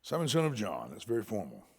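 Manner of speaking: normal talking voice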